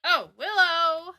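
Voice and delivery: loud, drawn-out oh of remembering; sudden realization. Real, not acted. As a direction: blurt it out as it comes back to you. Suddenly remembers forgotten character.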